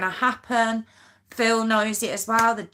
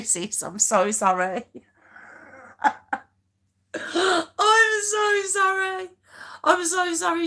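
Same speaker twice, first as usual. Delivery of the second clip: laughing apology; 8 semitones higher than usual. Real, not acted. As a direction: plead sorry while cracking up.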